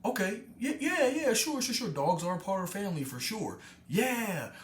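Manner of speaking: high-pitched nervous yeah sure sure; awkward humouring agreement. Retold or imitated. Group humours the dog guy, high nervous agreement. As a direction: placate him, voice jumping way up. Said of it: "And everybody will be like".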